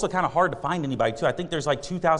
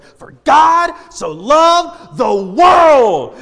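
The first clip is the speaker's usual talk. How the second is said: slow, very high shout, far louder, stretched out; ecstatic screaming; 11 semitones higher, 13 dB louder, much slower than usual. Retold or imitated. Crazy street man screaming the verse at soul winners. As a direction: bellow it like a man possessed by joy. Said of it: "starts screaming it from the top of his lungs".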